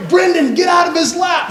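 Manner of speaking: high, urgent mock dad's call; scolding dad. Father retelling scolding his son.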